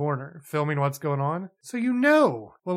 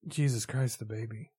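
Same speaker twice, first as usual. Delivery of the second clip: put-on "Jesus Christ, the baby" said flat and a bit softer, trailing off; flat deadpan movie line; 5 semitones lower, 7 dB quieter than usual. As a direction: read it like a bored actor with no alarm. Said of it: "That is a very good reenactment of that scene".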